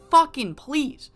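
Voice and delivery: high, loud sarcastic scoff; an incredulous, mocking brush-off; scoffing disbelief. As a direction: throw it back at him with open contempt. Sky scoffing at Heartbreaker.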